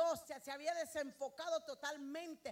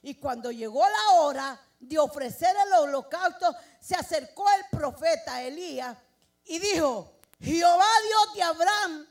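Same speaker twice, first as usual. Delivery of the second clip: loud preacher proclaiming the story; full-force preaching; 2 semitones higher, 13 dB louder than usual. Acted. Preacher retelling Elijah's altar to the church, full-force preaching.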